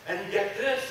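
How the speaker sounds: normal talking voice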